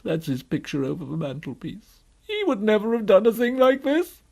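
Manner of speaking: woeful voice rising high and loud; lamenting faun. Acted. Faun comparing himself with his father, lamenting. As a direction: build from subdued to a loud wail.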